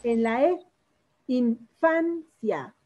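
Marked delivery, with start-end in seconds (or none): 1.3-2.7 Infancia very slowly split, FAN stressed; teacher sounding out in... FAN... cia in Infancia. Shown as a demonstration. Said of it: "la vocal fuerte".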